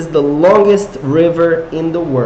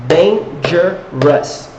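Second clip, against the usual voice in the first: "Dangerous" drawn out slowly, each syllable split apart; much slower than usual. Shown as a demonstration. Teacher counting syllables for students, slow and drawn out. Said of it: "How many syllables?".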